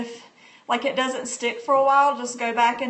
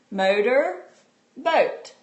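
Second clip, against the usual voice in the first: split into parts; much slower than usual. Shown as a demonstration. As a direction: say motor and boat as two separate words with a pause between. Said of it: "called Say It Fast".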